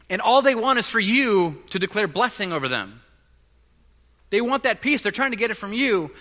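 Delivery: loud, high sermon voice; impassioned preaching. Acted. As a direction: press the point to the congregation. Preaching at full force.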